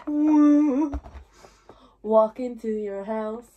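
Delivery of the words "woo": loud excited whoop; woo. Acted. Friend about to sing lets out a loud woo. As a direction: let out a hype woo. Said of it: "why was I about to go like".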